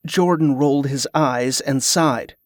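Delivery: usual speaking voice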